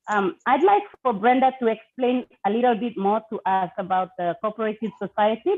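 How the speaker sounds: ordinary talk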